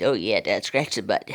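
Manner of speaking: low, put-on voice for a dog; dog's voice. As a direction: speak as a blissed-out dog. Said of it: "He says".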